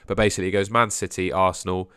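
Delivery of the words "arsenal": ordinary talk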